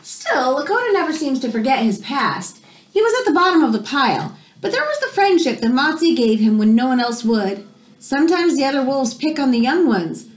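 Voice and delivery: gentle storybook narration; reading to children. Acted. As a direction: read it like story time for a class. Reads picture book to class.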